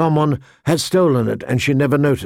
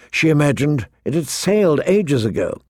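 ordinary delivery, nothing marked

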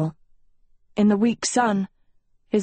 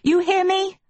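insistent; 11 semitones higher than usual. Acted. Sophie insists, whirling around.

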